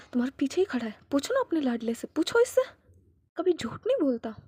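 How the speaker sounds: jab at him to ask his darling; angry taunt. Acted. Father sneering at the brother to ask his favourite, loud.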